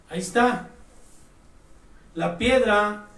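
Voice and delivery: ordinary talk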